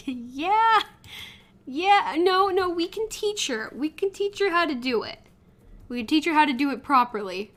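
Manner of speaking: high, eager reassurance; flustered. Acted. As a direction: hurry to win her over.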